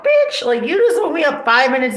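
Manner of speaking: high, loud disbelieving yelp; incredulous high-pitched bitch; outraged. Real, not acted. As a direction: spit it out in shocked outrage. Outraged retort in family fight.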